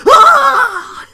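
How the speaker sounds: very high, very loud jump-scare scream; piercing imitation of a horror-game scream; Brock scream. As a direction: shriek it suddenly at full volume. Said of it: "it's just the Brock scream".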